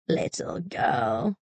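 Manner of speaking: a quiet threat from the vixen; menacing vixen. A narrator's reading. Fox threatens.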